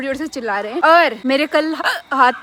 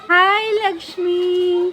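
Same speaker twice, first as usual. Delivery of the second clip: very slow, higher-pitched coo; cooing greeting; 4 semitones higher, much slower than usual. Real, not acted. Vlogger greets little Lakshmi, cooing. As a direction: draw the hello out fondly, pitched up.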